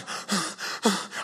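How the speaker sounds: breathless mock gasp of terror; imitated frightened gasp. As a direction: suck in air like the wife in shock. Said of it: "And she's going".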